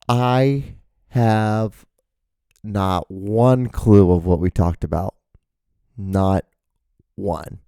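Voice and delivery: slow, word by word; emphatic cluelessness. Retold or imitated. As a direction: spell it out with long pauses between words. Quotes own blank when writing description.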